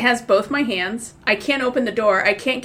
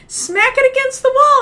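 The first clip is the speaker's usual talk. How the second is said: much higher, louder urgent call; urgent advice; 6 dB louder than usual. Retold or imitated. Bill telling her to smack the hawk, high and loud. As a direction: bark out the fix quickly. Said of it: "And he's like".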